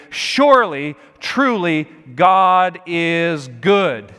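each word landed firmly, stretching out GOD IS GOOD; emphatic. Acted. Preacher voicing the psalm's verse one to the congregation, emphatic. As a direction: declare it with weight, drawing the last words out slowly. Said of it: "and say emphatically".

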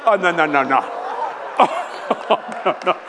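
loud, laughing refusals; loud mock panic. Dreading his own anniversary speech, loud laughing panic. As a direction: panic in fun at the idea. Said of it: "dreading the speech".

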